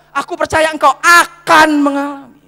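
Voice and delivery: "akan" stretched and hit loudly for emphasis; stress on AKAN. Shown as a demonstration. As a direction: lean hard on the word akan. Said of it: "kalau kata akan berarti sudah belum".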